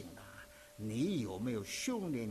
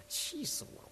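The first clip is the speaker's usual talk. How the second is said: mock exasperation; 14 semitones higher, 5 dB quieter than usual. Retold anger at failing grade.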